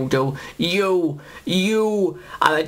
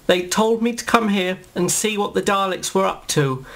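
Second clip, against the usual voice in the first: ordinary talk, nothing marked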